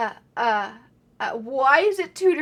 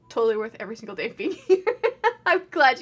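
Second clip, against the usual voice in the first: laughing; 5 dB louder, much faster than usual. Real, not acted. Laughs while reading fast.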